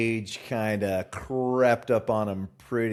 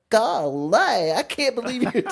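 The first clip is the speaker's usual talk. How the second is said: high, loud mimicked Southern twang; mock the Southern accent, pitched way up; 5 dB louder than usual. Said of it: "my wife will go".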